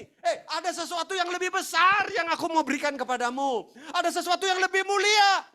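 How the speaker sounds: loud, high, urgent proclamation; suara Tuhan. Acted. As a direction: proclaim a great promise at full volume. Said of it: "Padahal Tuhan bilang gini". (seruan Tuhan, lantang dan tinggi)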